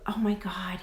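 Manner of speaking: breathless whispered gasp of a line; whispered surprise. Real, not acted. Host reacting to a viewer's birthday, in an amazed whisper.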